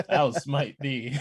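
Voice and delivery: slow, laughing archaic threat; mock biblical prophet. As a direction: boom a smiting decree, hammed-up.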